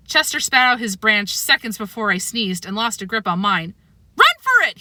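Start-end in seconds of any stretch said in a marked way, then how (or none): 4.2-4.8 high, loud panicked squeal; frantic squeal to flee. A narrator's reading. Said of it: "he squealed".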